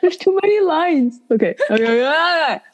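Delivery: high laughing through words; giggling, trying to recover; laughing fit. Real, not acted. Can't stop laughing while reading.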